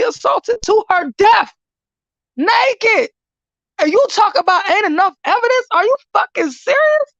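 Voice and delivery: high-pitched, incredulous anger; real outrage. Real, not acted. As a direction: let the pitch soar in disbelief and fury. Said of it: "I'm so pissed".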